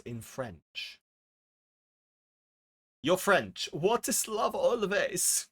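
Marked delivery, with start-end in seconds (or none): 3.7-5.5 slow, higher mock German accent; a playful German accent, drawn out and higher. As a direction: ask it in a hammed-up German accent. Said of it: "Why did I do that in a German accent?".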